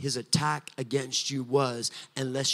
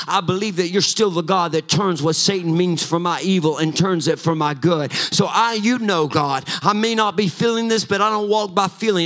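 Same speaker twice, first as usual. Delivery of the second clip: louder and higher, chanting, building preaching; preaching at full force; 5 semitones higher, 8 dB louder than usual. Acted. Pastor declaring victory over the enemy to the church, full-force preaching. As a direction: drive each "coming out" line harder, near song.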